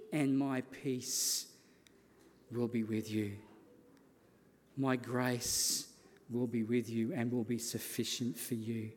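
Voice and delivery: low, softened promise of comfort; hushed, solemn promise; gentle reassurance. Acted. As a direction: settle down low and quiet, as God reassuring. Voicing God's promise of peace.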